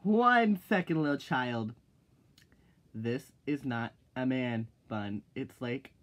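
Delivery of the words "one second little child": loud retort. Shown as a demonstration. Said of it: "loud retort, repeated later softer".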